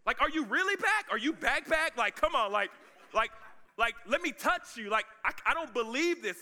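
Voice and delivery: high, loud, stammering amazement; excited disciple who can't believe it; excited disbelief. Acted. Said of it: "and they're excited".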